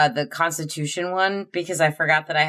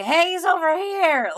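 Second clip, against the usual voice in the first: yelling. Pretend. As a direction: call out with a high shout. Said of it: "would probably yell".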